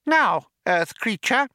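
high-pitched, formal little mouse. A narrator's reading. Benji addresses Arthur to start business, mouse voice. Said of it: "Benji moved forward to address Arthur".

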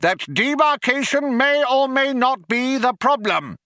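high-pitched, strident declaration; yelling. A narrator's reading. Younger philosopher qualifies his colleague's complaint, yelling. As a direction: yell it as a formal demand. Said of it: "yelled Vroomfondle".

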